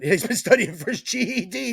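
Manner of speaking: high mocking voice; mocking imitation. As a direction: mimic him teasingly. Mocking friend's line.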